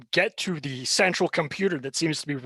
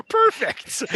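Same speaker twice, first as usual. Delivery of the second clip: ordinary delivery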